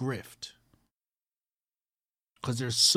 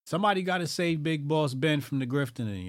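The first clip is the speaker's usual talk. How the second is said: usual speaking voice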